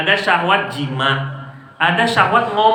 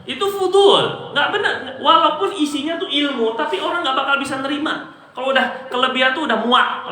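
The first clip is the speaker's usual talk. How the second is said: laughing; 7 semitones higher than usual. Real, not acted. Laughing at long-winded preachers.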